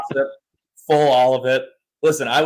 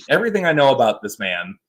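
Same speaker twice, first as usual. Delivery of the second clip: ordinary talk, nothing marked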